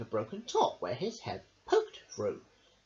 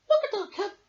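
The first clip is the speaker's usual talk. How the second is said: put-on very high, loud girlish cry; high, loud thrilled child; excited shout; 7 dB louder than usual. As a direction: call out gleefully like a little girl. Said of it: "shouted Kath".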